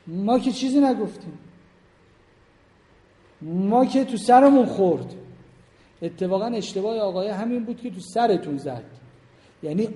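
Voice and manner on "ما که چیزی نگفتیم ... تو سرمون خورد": rising higher and louder to a protesting peak; mock innocent protest. Preacher mimics wives protesting innocence, indignant and rising. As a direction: play the wives insisting they did nothing, building up.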